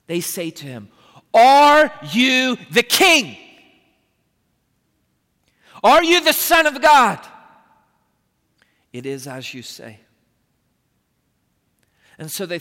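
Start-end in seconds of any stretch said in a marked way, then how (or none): 1.3-7.2 accusing question. Acted. Sanhedrin interrogates Jesus at trial. 8.9-10.0 quiet answer. Acted. Jesus answers the Sanhedrin quietly.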